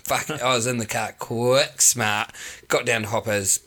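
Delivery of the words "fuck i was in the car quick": normal talking voice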